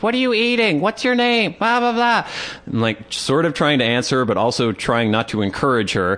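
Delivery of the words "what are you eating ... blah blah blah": put-on high, squeaky kid questioning; toddler girl. Storyteller plays a little girl quizzing a stranger, high child voice. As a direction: pepper it out in a mock child voice. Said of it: "starts asking me all these questions".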